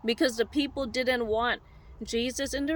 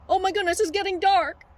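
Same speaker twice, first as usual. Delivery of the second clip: higher, mock-panicked sheep; freaking out; 4 semitones higher, 4 dB louder than usual. Sheep sees night coming, high mock panic. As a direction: raise the pitch in comic alarm. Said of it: "the sheep be all freaking out".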